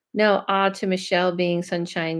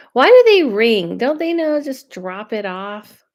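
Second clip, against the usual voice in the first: exasperated; 2 semitones higher, 7 dB louder than usual. Real, not acted. Annoyed at delivery doorbell.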